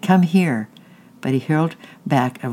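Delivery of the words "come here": loud call across the yard, pitched up; shouting. Acted. Kate shouts after her runaway foster brother. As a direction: yell his name after him. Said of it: "she shouted".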